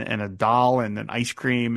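usual speaking voice

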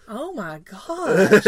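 loud, long drawn-out mock gasp of disbelief; mock shock. Co-host imitating her disturbed friends, mock shocked. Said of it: "'cause you're like".

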